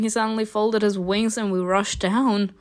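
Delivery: ordinary talk, nothing marked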